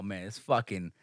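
usual speaking voice

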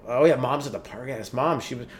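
usual speaking voice

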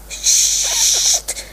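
sharp voiceless hiss; hissed shush. A narrator's reading. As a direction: shush her hard, all breath. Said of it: "sist Olaf". (sissend stil)

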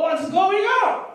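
high, drawn-out puzzled question; stretched, high-pitched bewilderment; startled question. Acted. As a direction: ask it high, as the brother hearing a party. Older brother hears the party.

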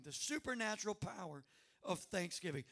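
normal talking voice

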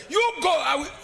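high, loud mock dismissal; indignant retort. Wife sending husband off alone, loud indignant retort. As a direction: send him off in comic protest.

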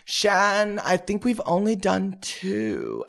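high, slow, drawn-out imitated voice; lilting, unhurried impersonation, stretched out. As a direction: correct a friend gently in a put-on high voice. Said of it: "And she goes".

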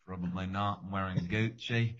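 a light take on [name]. Retold or imitated. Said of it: "he's got a weird voice".

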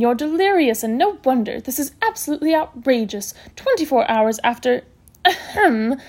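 emphatic scolding with swooping pitch; bossy matron, outraged. Acted. Matron ordering a patient to lie down, outraged. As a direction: boss the patient back to bed.

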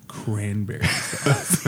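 low, very slow enunciation; deliberate insistence. Retold or imitated. Brother repeats password to confused bartender, slow and insistent. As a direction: spell it out slowly for someone not getting it.

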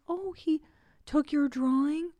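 concerned teacher. Retold or imitated. Teacher hearing about a taken drawing, imitated concern. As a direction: mimic a teacher really listening to a child. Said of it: "she was so concerned".

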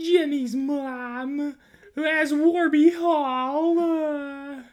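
very high, slow falsetto British voice; a squeaky put-on upper-class British lady; hammed-up falsetto accent; different British accent. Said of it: "try a different accent".

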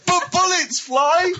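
drawn-out, high-pitched whine; exasperated protest. Real, not acted. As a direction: wail the rebuttal, nearly crying. Exasperated rebuttal of Carl's logic.

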